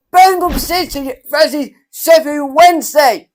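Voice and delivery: high, loud team announcement; big, high-energy shout; excited fixture call. Real, not acted. Child calls out the fixture.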